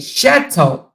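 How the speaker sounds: "Shetto" opening on a clear SH; CH pronunciation. Shown as a demonstration. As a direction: hit the "sh" at the start of "Shetto". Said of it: "She said more like C H".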